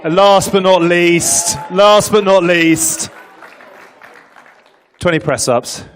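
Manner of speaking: slow, loud drawn-out announcing; drawn-out announcement. Acted. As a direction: stretch it out like a ring announcer. Announcing latecomer to the room.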